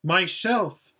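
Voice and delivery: high, loud, glad proclamation. A narrator's reading. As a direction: say it out loud and joyfully.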